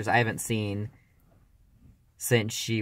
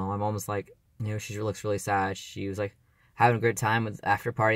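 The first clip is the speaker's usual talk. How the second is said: ordinary talk, nothing marked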